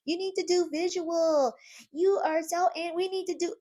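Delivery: high, whiny voice of demanding fans; lightly mocking fan requests. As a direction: parrot the listeners' nagging. Imitating listener feedback.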